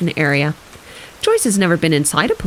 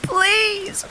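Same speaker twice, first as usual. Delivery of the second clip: crying plea; 14 semitones higher than usual. Acted. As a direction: beg it in a high, tearful voice. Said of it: "cries Elizabeth".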